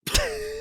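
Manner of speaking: high, squeaky turkey gobble; gobbling turkey voice. Pretend. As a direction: gobble like a turkey, full throat. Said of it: "You wanna hear my gobble?".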